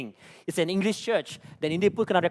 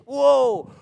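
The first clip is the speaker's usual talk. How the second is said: loud, high, stretched exclamation; astonished outburst; 8 semitones higher, 7 dB louder than usual. Acted. Preacher reacting to being told to preach, loudly astonished. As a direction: burst out in big amazement.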